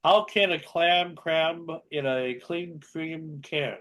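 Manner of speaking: slow, deliberate tongue twister; slow careful reading. Shown as a demonstration. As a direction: say each word slowly. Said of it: "tongue twisters".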